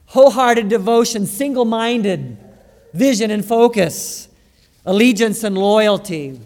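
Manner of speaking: fervent pulpit preaching. Acted. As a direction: drive the sermon home to the congregation. Preaching on salt passage.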